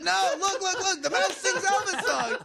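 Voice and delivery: high-pitched pleading insistence; hammed-up desperate showman; frantic exhibitor. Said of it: "Like, he, he, he says".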